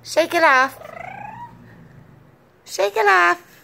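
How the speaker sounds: high, loud cheering on; teaching a parrot. Real, not acted. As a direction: egg the bird on, high and loud. Urging the parrot on.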